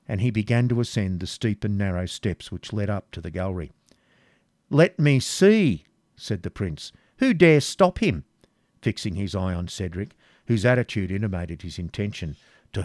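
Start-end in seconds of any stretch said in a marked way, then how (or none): 4.7-5.8 high, loud, drawn-out words; defiant challenge. A narrator's reading. Prince daring anyone to interfere, loud and defiant. 7.2-8.3 high, loud, slow challenge; defiant prince. A narrator's reading. Prince defying Cedric to stop Isaac, high and loud. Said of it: "the prince's defiance".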